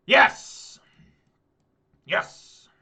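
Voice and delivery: loud, breathy cry of victory; triumphant shout. Real, not acted. Player celebrating the card he needed, shouting. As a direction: burst out with relief and joy.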